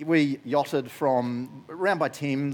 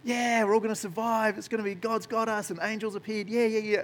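much higher, giddy crew voice; cheering crew; 9 semitones higher than usual. Retold or imitated. Crew celebrates surviving, much higher giddy chatter. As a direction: gush like carefree sailors. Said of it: "they're all like".

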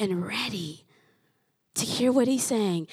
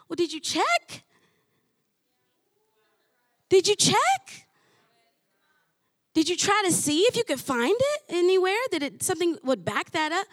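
pointed challenge; 6 semitones higher than usual. Retold or imitated. Her own retort to a doubter.

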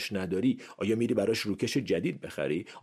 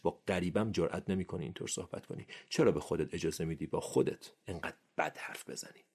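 normal talking voice

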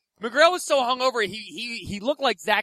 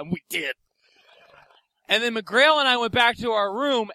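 ordinary delivery

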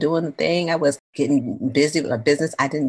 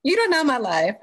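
high-pitched, sassy reality-star impression; Nene Leakes; 10 semitones higher than usual. Telling off worried friends in Nene Leakes voice, sassy. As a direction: brush them off like a reality diva. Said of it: "in my Nene Leakes voice".

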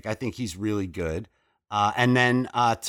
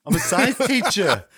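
Boston Wahlberg impression; 9 semitones higher, 4 dB louder than usual. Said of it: "Mixed with his Boston accent".

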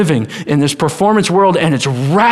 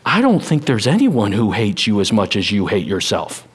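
loving tone; 8 semitones lower than usual. Retold or imitated. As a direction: say it tenderly to someone hurting. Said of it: "in a very loving tone".

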